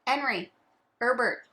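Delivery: "Henry, Herbert" both missing the H; dropped H. Retold or imitated. Imitating people who drop it, "Henry, Herbert" without H. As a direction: start "Henry" and "Herbert" on their vowels. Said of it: "they say".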